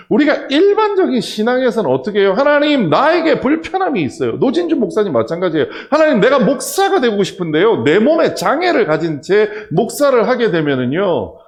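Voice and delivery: loud, high pulpit preaching; full-force preaching. Acted. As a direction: drive it home to the congregation.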